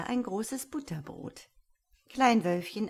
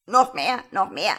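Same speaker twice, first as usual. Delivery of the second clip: insistent parroting bird, much louder; starling's call; 2 semitones higher, 10 dB louder than usual. A narrator's reading. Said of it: "rief der Star".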